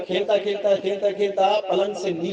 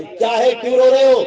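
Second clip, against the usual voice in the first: loud, higher scolding shout; much louder angry mother's yell; 6 semitones higher, 10 dB louder than usual. Acted. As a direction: snap at the crying child. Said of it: "माँ ही चिल्लाती आई".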